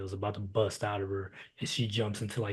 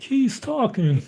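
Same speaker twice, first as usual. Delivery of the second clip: mock whiny voice; 6 dB louder, much slower than usual. Mocking bored moviegoer.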